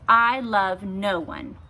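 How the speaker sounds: slow, loud and proud; proudly. A narrator's reading. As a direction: declare it with haughty pride. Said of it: "said the princess proudly".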